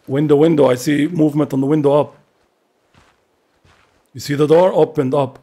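loud, urgent warning to teammate; urgent call-out. Real, not acted. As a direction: call out the threat sharply. Spotting enemy movement, calling out.

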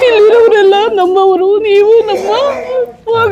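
high voice breaking into tears; a mother weeping over her lost son; crying. Real, not acted. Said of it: "ಆಯ್ತಮ್ಮ, ಅಳ್ಬೇಡಿ". (ಅಳುತ್ತಾ)

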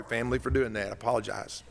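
usual speaking voice

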